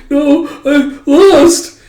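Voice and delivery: put-on very high, slow wail of a pouting girl; whiny kid loss. Little girl wailing that she lost, slow high whine. Anya losing the game.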